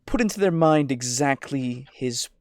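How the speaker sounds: slow, insinuating near-whisper; relishing NPC voice. Shown as a demonstration. Player shows faction leader planting his purpose, slow whispery relish. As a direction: draw it out, fading into a whisper. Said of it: "something like that".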